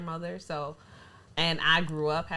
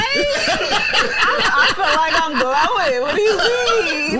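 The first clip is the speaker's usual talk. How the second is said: much louder and higher, through laughter; laughing loud protest; 9 semitones higher, 14 dB louder, much slower than usual. Real, not acted. Friend defending the coconut drink to teasing co-hosts, loud laughing protest. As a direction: shriek it out while cracking up.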